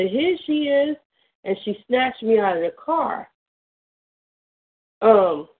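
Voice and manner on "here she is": mild mock yell, higher pitch; a hint of a mother's shout to her husband; retold scream. Mother finding her child in the car, mild mock yell. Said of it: "she screamed to my father".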